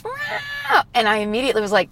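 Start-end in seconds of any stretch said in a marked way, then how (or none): 0.0-0.8 one very high, falling kitten mew; a grateful little kitten meow; high squeaky thank-you mew. Retold or imitated. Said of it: "it goes".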